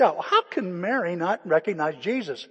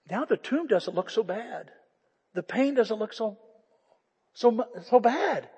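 ordinary talk

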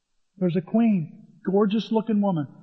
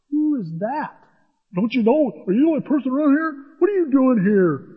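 higher, needling big-brother scorn; mocking brothers; 6 semitones higher than usual. David's brothers giving him a hard time, higher and scornful. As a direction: jeer at the little kid.

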